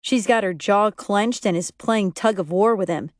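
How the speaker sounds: usual speaking voice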